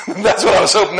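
a real laugh breaking through the words; high, loud, laughing aside. Real, not acted. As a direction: laugh while admitting what he hoped. Laughs recalling the hoped-for question.

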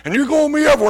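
preacher calling his partner before the group; fervent preaching. Retold or imitated. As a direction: announce it like a prophecy. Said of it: "And so he said".

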